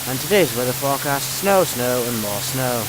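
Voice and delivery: radio weather announcer voice; TV weather forecast. Acted. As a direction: read it like a TV weatherman.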